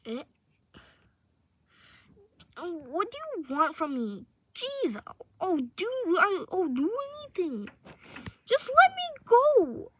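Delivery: high, loud captive pleading for release; exasperated high-pitched acted wail; listener's voice. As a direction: beg desperately to be let go. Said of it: "try to do listener's voice".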